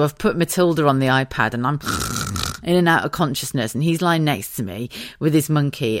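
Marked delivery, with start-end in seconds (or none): none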